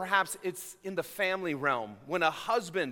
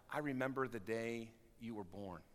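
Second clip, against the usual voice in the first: soft, lower and tender; tender memory; 7 semitones lower, 11 dB quieter than usual. Retold or imitated. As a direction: hush it like a fond parent's memory. Parent recalling child's birth.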